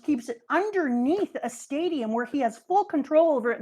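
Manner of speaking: ordinary delivery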